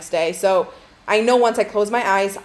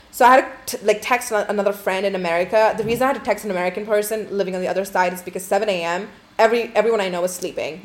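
ordinary delivery

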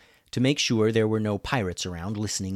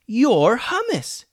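high, loud, drawn-out exclamation; amazed shout; yelled; 7 dB louder, much slower than usual. A narrator's reading. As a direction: yell it in excited recognition. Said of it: "yelled Julian".

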